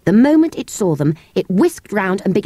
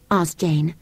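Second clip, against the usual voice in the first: plain reading voice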